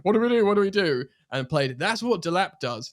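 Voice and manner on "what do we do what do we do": mock panic. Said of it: "That went".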